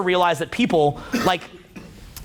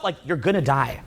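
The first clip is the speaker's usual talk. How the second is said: normal talking voice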